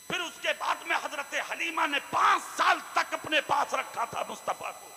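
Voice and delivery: rising sermon delivery; preaching. Acted. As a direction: tell the hardship at full voice. Preacher narrating the Prophet's life.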